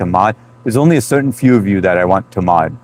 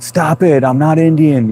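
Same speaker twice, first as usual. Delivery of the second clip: mock protest; 7 semitones higher, slower than usual. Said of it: "and I'd be like".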